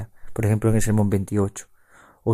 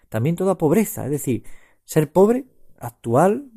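raised, higher prayerful plea to Christ; fervent prayer; 4 semitones higher than usual. Acted. Preacher voicing the oblation to the Lord, fervent prayer. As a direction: pray it out, ardent and lifted.

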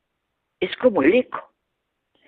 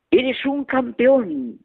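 echo reply; 9 semitones higher, much slower than usual. Retold or imitated. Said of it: "la voz nos devolvió".

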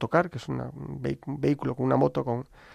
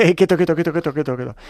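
quick, raised-voice "hold on"; retold alarmed halt; 3 semitones higher, 6 dB louder than usual. Priest stopping strangers delivering beans, retold alarmed halt. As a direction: fend someone off with rapid repeated words.

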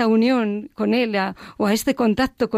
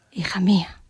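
inner voice whisper. Retold or imitated. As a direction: breathe it like a voice heard inside. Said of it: "Y me decía".

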